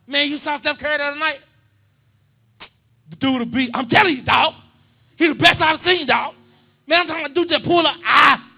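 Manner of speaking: high, full-volume excited shouting; boisterous shout that sounds like a fight; heated family banter. Acted. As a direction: yell it like a friendly argument about basketball. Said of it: "think we're about to have a fight".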